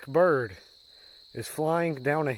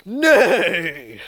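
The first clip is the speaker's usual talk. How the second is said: loud horse denial; 3 semitones higher, 8 dB louder than usual. Acted. Horse agent answers the punchline.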